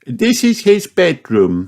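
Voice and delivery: high, lively aunt voice; Auntie Pig voice. A narrator's reading. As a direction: speak as the bustling aunt. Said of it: "says Auntie Pig".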